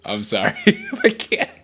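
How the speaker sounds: real laugh bubbling through the words; laughing apology. Real, not acted. Streamer apologizes to chat for not speaking Indonesian, laughing. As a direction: break into a high laugh while apologizing.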